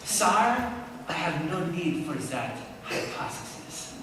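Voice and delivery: light mock French accent, quiet on the hypothesis; Laplace in French accent. Laplace answering Napoleon, in a lightly mocked French accent. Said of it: "replies in a French accent".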